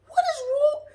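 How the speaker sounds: high cracking cry of disbelief; shocked crying out. Retold or imitated. To her friend with binoculars, a high shocked wail.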